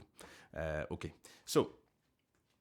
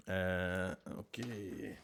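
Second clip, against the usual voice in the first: usual speaking voice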